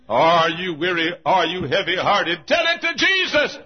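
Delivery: slow loud questions rising to a high cry; loud exhortation. Real, not acted. Preacher urging the weary to Jesus, loud and building. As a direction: ring it out over the congregation.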